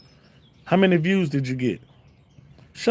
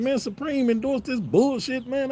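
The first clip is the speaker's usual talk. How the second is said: much higher, mock-disgusted customer griping; mock fed-up customer; 12 semitones higher than usual. Customers mocking the brand in a high voice. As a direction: squeak out a buyer's complaint. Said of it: "Then they're like".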